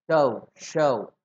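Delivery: "चौ, शौ" slowly, each with an au diphthong; "चौ, शौ" drawn out, each ending on a u glide; correct pronunciation. Shown as a demonstration. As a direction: glide from a to u in both "चौ" and "शौ". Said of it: "ये सही उच्चारण है आपके".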